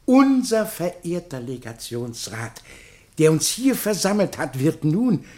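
ceremonious address with long pauses between the titles; pompous public speech; formal speech. Acted. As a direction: address the assembled society solemnly, like a festive orator.

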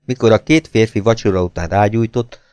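ordinary delivery, nothing marked

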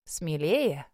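low, gentle reassurance; warm encouragement. A narrator's reading. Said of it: "ободрил он, и в его голосе прозвучала неподдельная теплота". (ободряюще, с неподдельной теплотой)

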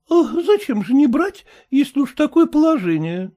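high, sour, fretful wife voice; high complaining wife; sour merchant's wife. A narrator's reading. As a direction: whine it like a sour housewife.

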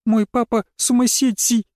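put-on high scared child voice; a small girl clinging in fear; frightened girl. A narrator's reading. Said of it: "прошептала она".

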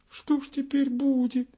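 high, tearful tiny voice; a little minute crying in despair; Minutka sobbing. Acted. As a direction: whimper it like a lost child. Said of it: "всхлипнула Минутка".

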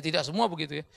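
ordinary talk, nothing marked

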